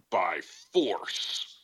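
dramatic stage whisper from the pulpit; whispered mock-prophetic threat; Pastor X. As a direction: hush it into an ominous preacher's whisper. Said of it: "your Pastor X voice".

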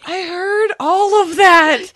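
high, loud, slowed knowing remark; deadpan it like you heard every word; mock knowing comeback; pointed aside. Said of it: "And I'm just like".

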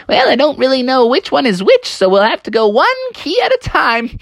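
high weasel voice with a cackle; weasel janitor. Acted. Weasel janitor explains the keys to Froggy, high and cackling. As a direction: play a dithering weasel, ending in laughter. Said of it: "he's like".

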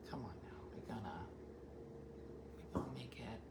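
soft secretive whisper; whispered plea. Retold or imitated. Dina whispering to the landscaper about her plants. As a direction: breathe it, no voice.

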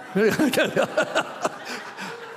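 breathless high cackle of delight; high laughing fit. Real, not acted. Preacher to congregation, laughing at the shrunken devil, high giggle. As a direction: let the laugh spill out.

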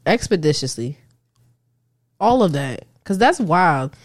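normal talking voice

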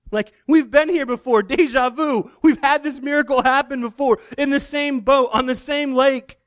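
riding the thrill of it happening again; excited retelling. Retold or imitated.

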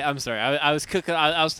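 ordinary talk

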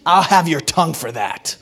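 a hint of a menacing king; lightly voiced cold royal threat, half laughing; playful House of Dragon king threatening a subject; mock royal threat. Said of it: "That's how they do it in House of Dragon".

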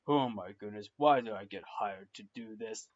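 lightly voiced pastor, low-pitched complaint; exasperated pastor. Pastor regretting being hired, low mild exasperation. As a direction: grumble low as a fed-up priest. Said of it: "the pastor is like".

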